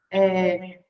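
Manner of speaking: usual speaking voice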